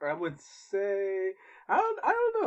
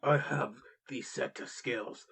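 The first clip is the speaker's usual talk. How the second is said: Liam Neeson impression; 5 semitones lower than usual. Friend quoting the action star's threat, low menacing. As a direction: growl the famous threat low. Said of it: "he would say".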